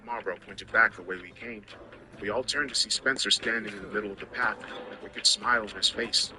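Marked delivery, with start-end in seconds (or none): none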